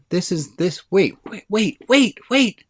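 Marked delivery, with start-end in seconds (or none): none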